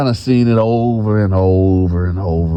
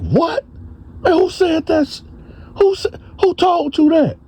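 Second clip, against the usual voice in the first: shocked denial. Retold or imitated. Bone accused, reacting in shock.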